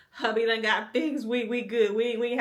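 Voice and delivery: chuckling as she says it; laughing. Real, not acted. Laughing about no more babies.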